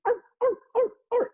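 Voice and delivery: very high, loud yapping barks; tiny dog yaps. Retold or imitated. Narrator imitating the lady's tiny dog, very high loud yaps. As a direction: yap like a tiny lapdog. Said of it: "a tiny dog that's barking".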